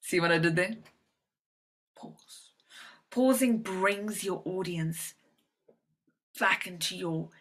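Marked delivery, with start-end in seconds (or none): 1.9-2.5 near-whispered single word; barely voiced, secretive hush. Shown as a demonstration. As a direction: breathe the word out very softly. Demonstrates pause after long silence.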